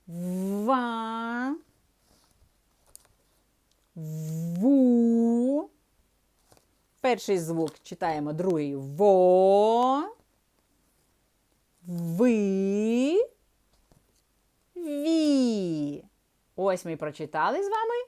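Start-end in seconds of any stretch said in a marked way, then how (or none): 0.1-5.7 syllables read slowly as one smooth sound; blended syllables. Shown as a demonstration. Teacher reading syllables to pupils, blended slowly. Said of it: "Прочитаємо злито". 8.7-16.0 syllables read slowly as one smooth sound; blended syllables. Shown as a demonstration. Teacher reading syllables to pupils, blended slowly. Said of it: "Прочитаємо злито".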